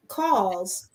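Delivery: normal talking voice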